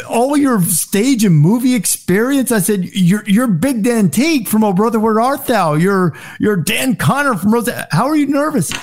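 high, disbelieving questions; can't believe a star gets nervous; incredulous. Retold or imitated. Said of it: "I'm like".

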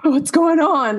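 high-pitched imitation of alarm; mock panic. Imitating a dreamer waking from a parent's death, mock panicked cry. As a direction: act out waking in a fright.

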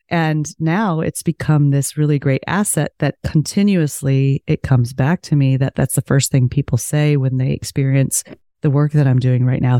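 ordinary talk

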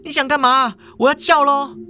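high, alarmed woman's voice; hammed-up alarm, high-pitched; panicked. A narrator's reading. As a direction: warn off an attacker in mock panic. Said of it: "语气惊慌地说".

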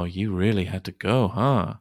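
ordinary delivery

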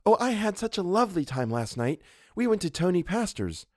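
put-on high, girlish, gossipy chatter; chattering young model. A narrator's reading. Model telling the painter about last night, chattering. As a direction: gush about a lovely evening. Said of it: "she began to chatter".

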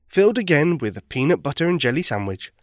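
ordinary delivery

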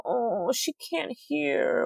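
condescending pity, drawn out. Retold or imitated. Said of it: "does this pity thing".

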